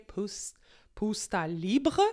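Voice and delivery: slow, hammed-up German accent on Pusta Libre; bad German accent. Shown as a demonstration. Host says the Austrian wine's name in a self-mocking German accent. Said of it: "c'est mon accent allemand qui est désastreux".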